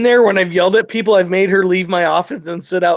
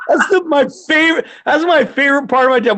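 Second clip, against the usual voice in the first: ordinary talk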